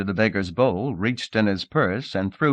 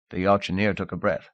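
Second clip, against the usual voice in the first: ordinary delivery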